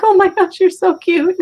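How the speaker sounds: high, loud cooing over a cat; gushing baby-talk coo. Retold or imitated. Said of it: "And I'm like".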